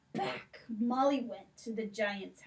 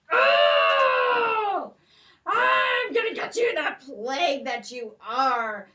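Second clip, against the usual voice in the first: furious giant bellowing, much higher and louder; roaring giant; 10 semitones higher, 13 dB louder than usual. Acted. Giant wakes and roars at Molly for stealing his sword. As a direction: roar with rage, a monster about to eat her.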